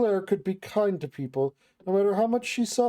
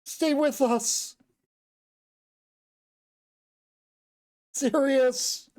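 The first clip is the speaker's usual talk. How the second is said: high, drawn-out cry of a name; loud calling out; 5 semitones higher, much slower than usual. Acted. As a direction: call someone back from far away. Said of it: "shout over and over with that stupidly loud voice".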